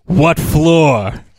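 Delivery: flat loud punchline; deadpan reply. Retold or imitated. Quoting the movie punchline, loud and deadpan. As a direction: deliver it dry and loud.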